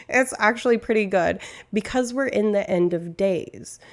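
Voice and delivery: ordinary delivery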